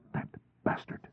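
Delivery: whispered curse under her breath; muttered. A narrator's reading. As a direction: hiss it quietly to herself. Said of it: "she muttered".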